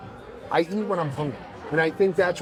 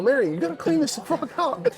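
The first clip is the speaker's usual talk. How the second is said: high, mock outraged complaint; imitated outrage; 8 semitones higher than usual. Calling to his sister Mary, a hammed-up high outraged complaint. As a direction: play up the disgust to his sister.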